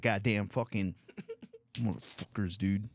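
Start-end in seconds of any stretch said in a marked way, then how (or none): none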